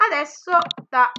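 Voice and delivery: normal talking voice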